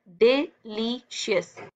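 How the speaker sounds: "Delicious" in three slow, separate pieces; syllable by syllable. Shown as a demonstration. Teacher showing breaths per word, syllable by syllable "delicious". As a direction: break "delicious" into डि, ली, शियस with pauses. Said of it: "तीन सांसों में पढ़ रहे हो".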